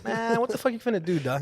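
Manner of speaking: a hammed-up street taunt; mock tough taunt. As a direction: call after them like a cocky tough guy. Taunting guys who drove off.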